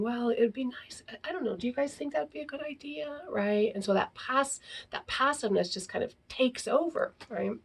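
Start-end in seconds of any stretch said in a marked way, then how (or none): 0.0-3.2 hesitant, half-whispered hint; timid hinting. Shown as a demonstration. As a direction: shrink away from asking, barely voiced. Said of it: "Rather than just saying".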